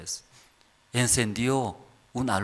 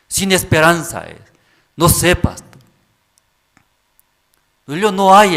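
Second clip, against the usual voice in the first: loud, high, pleading outburst; loud, higher, desperate plea to the pastor; desperate youth; 6 semitones higher, 11 dB louder than usual. Retold or imitated. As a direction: cry out for guidance like a lost teenager.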